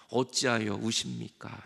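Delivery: slow, low, measured question; demonstrated greeting. Shown as a demonstration. Preacher showing congregation how to greet neighbours, slow low question. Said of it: "이렇게".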